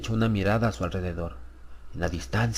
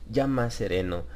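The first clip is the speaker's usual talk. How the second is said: ordinary delivery, nothing marked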